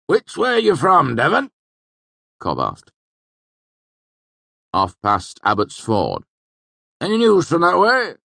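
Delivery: high, loud rustic question; curious old villager. A narrator's reading. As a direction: squint and ask, curious and loud. Cobb questions newcomer.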